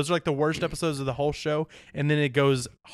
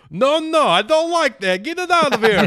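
much higher, louder Eastern European car dealer; bossy Eastern European accent, shooing them out; 13 semitones higher, 8 dB louder than usual. Retold or imitated. Car dealer shoos customers away, loud Eastern European accent. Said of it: "Can you do Eastern European accent again?".

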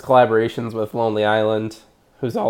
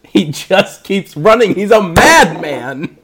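excited laughing; 5 semitones higher, 7 dB louder, slower than usual. Real, not acted. Excited about movie stunt.

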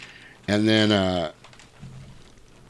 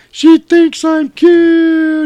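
very high, drawn-out elated shout; overjoyed cry; 11 dB louder, much slower than usual. Retold or imitated. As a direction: shout it as if taking off. Rudolph overjoyed, takes off flying.

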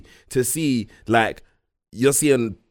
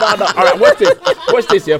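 laughing; 7 semitones higher, 4 dB louder, faster than usual. Real, not acted. Laughing while setting up example.

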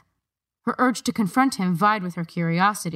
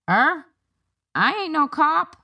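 higher, slow puzzled guard voice; surprised guard; 10 semitones higher, 3 dB louder, slower than usual. A narrator's reading. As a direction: toss it off as a baffled guard.

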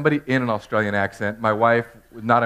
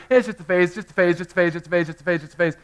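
repeated dismissive mantra; 6 semitones higher, 4 dB louder, faster than usual. Retold or imitated. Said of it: "I was like".

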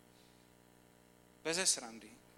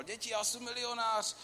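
higher, hammed-up shout of joy; mock ecstatic yell; 8 semitones higher than usual. Preacher retelling the film hero yelling to children, mock ecstatic. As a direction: crow it out like a new millionaire. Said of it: "řval".

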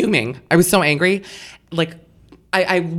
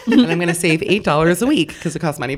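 ordinary talk

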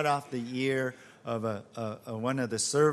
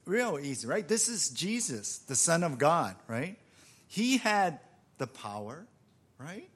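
ordinary talk, nothing marked

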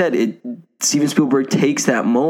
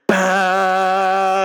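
brass note; 9 semitones higher than usual. Retold or imitated. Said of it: "such a loud brass noise".

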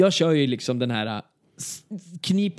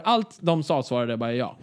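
normal talking voice

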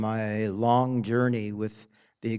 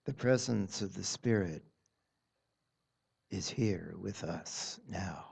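soft spa whisper; 3 semitones lower, 4 dB quieter than usual. Acted. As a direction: ease them gently into stillness. Leads a guided meditation.

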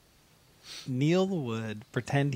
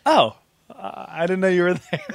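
higher, louder mock startle; feigned surprise; 5 semitones higher, 9 dB louder than usual. Student pretends not to have noticed the teacher, feigned surprise. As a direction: act badly caught off guard. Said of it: "he'd act surprised to see her".

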